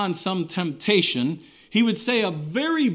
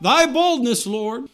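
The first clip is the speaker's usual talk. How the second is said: higher, louder pleading cry to the Lord; fervent cry; 7 semitones higher, 5 dB louder than usual. Retold or imitated. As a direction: lift the plea up high, calling out to God. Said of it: "you can cry out".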